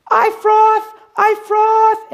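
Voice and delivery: high, loud, squealing fan voice; excited fan lady. Retold or imitated. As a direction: gush it out like a thrilled stranger running up. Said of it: "And this lady comes".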